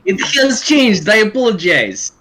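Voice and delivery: put-on loud, high Russian accent. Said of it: "Russian Bat Pony is best Bat Pony".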